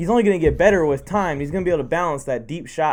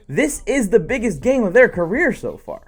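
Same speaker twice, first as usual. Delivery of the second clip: normal talking voice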